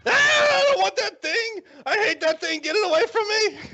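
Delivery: very high, loud, wailing screech; shrieking disgust. Acted. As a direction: scream it away from you in revulsion. Girlfriend rejects the gift in horror.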